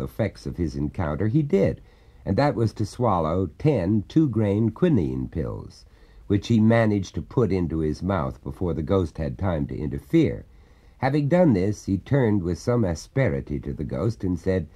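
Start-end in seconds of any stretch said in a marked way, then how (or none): none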